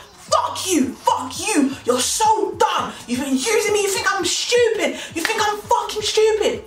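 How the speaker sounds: high-pitched angry rant, acted out; screaming to himself. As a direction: imitate him raging, punching out each phrase. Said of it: "he's literally screaming and talking to himself".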